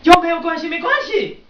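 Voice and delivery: high, loud indignant Chinese; 生气地模仿; retold angry outburst. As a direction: mimic her angry scolding. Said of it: "she got really angry".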